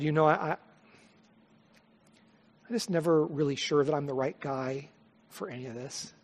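soft, low spoken prayer; hushed, humble prayer to God; closing prayer. Real, not acted. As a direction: pray quietly and humbly before the congregation.

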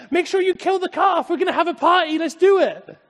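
very high, loud rapid-fire orders; so excited father. Acted. Father too excited to hear his son's apology, exuberant. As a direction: call out orders in overjoyed excitement. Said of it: "he was just so excited".

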